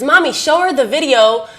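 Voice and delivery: a hint of an excited little boy; lightly voiced eager son. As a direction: imitate a child urging Mom on. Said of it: "he wants to tell them about a story".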